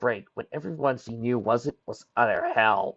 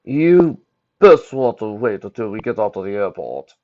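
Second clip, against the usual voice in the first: lightly voiced fellow traveller, louder; a louder character voice from the back seat; Ballard's voice; 8 dB louder than usual. A narrator's reading. Fellow traveller advising the teens from the seat behind, lightly voiced character.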